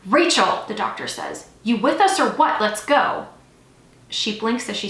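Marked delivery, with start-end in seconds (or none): 0.0-0.6 loud, sharp summons; shouted name. A narrator's reading. Doctor to nurse mid-emergency, shouted name. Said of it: "someone shouted her name". 1.6-3.3 loud, raised prodding; impatient urging. A narrator's reading. Doctor to a staring nurse, impatient urging.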